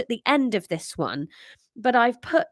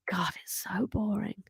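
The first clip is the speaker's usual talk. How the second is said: whispered aside; 9 dB quieter than usual. Real, not acted. Whispered aside about boring explanations.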